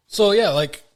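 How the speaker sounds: ordinary delivery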